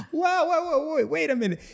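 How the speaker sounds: high, loud laughing protest; cracking up while waving it off; real laughter through the words. Real, not acted. Laughs while retelling the rant.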